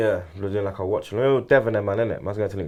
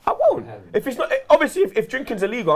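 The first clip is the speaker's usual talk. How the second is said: high-pitched protest; 8 semitones higher than usual. Real, not acted. Protesting playfully, voice jumps up.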